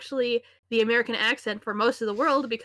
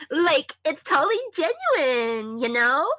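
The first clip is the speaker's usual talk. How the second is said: put-on slow, higher Valley Girl accent; hammed-up California Valley Girl accent; 5 semitones higher, 4 dB louder, slower than usual. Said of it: "you want the stereotypical American accent, hold on".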